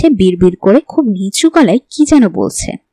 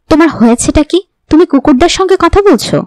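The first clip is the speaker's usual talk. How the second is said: angry; 7 semitones higher than usual. A narrator's reading. Husband angrily asks why his wife talks to dogs. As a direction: pitch rising high in angry disbelief. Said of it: "Taher রাগে গলায় বলল".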